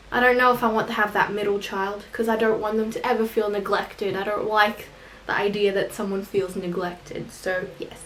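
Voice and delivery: ordinary talk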